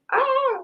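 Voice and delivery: high little squeal of excitement; excited squeal. Real, not acted. Fan excited about a surprise gift, high squeal. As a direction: let out a high, contained squeal. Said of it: "I'm so excited".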